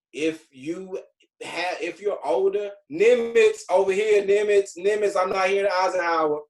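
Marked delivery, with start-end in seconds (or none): 2.9-6.4 high, loud shout-out to the crowd; rally the room by school name; calling out. Real, not acted. Calls out to schools in audience.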